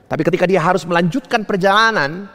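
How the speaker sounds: normal talking voice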